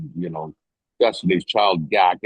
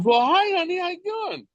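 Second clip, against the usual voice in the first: much higher, sing-song sweet voice; Kelly's sweet greeting. Retold or imitated. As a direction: coo it like a kind star greeting a kid. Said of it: "And Kelly looks at her and goes".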